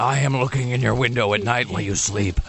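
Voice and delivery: a hint of a creepy stalker; lightly voiced creepy stalker. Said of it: "He sounded very creepy".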